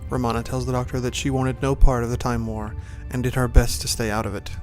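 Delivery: normal talking voice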